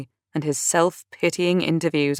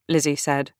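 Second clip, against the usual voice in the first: usual speaking voice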